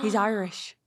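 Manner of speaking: half-hearted Irish accent, mocking. Shown as a demonstration. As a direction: try an Irish lilt. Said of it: "Is that good?".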